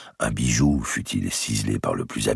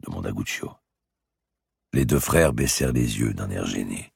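plain reading voice

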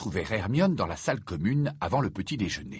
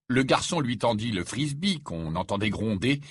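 ordinary delivery, nothing marked

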